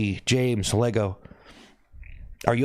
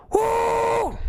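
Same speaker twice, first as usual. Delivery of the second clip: cheering woo. Real, not acted. Streamer cheering Jonathan's donation, slow high whooping shout.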